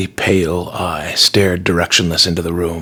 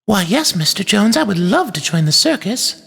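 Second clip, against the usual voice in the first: put-on high, sing-songy little-girl play voice; sing-songy child; 11 semitones higher than usual. A narrator's reading. Bella playing circus with her toys, high sing-song. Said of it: "whispering in a sing-songy voice".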